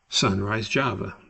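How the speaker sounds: normal talking voice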